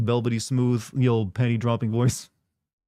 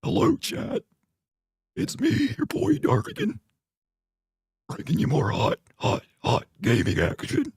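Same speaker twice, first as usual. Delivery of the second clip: strained, mostly high croak, put on; cancer patient voice; 11 semitones higher than usual. Streamer mock-greeting his chat, put-on sickly croak. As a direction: squeeze the words out like a failing patient. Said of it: "the cancer patient voice".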